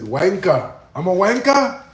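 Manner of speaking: high, loud, slowed British "bloody wanker"; mock British outrage. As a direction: ham up an offended Englishman. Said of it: "He said".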